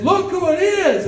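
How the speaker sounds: very high sing-song imitation; excited mother. Mother urges her son to notice the star, high excited imitation. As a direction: pitch it way up, eager to show someone off.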